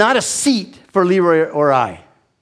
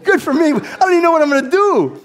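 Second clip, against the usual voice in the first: stunned disbelief; 10 semitones higher, faster than usual. Retold or imitated. Nominated man answering Leroy, high incredulous squeak. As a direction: blurt it out in disbelief.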